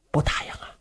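whispered, sly repeat; hushed echo. Acted. Du Cha slyly echoes Luo Cheng's doubt, whispered. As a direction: breathe the words back slyly.

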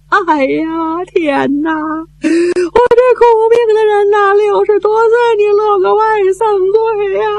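put-on high, wailing old woman's lament, with sobs; an old mother howling out her grief. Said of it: "哇的一声就哭出来了".

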